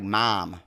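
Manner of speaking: "Mom" with a fronted, open vowel; Omaha pronunciation. Shown as a demonstration. Omahan showing how they say "Mom", demonstrating. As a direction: say "Mom" closer to "Mahm". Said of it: "we do say".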